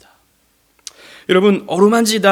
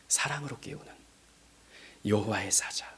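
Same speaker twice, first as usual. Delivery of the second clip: whispered tenderly; 7 semitones lower, 13 dB quieter than usual. Real, not acted. Tender image of loving wake.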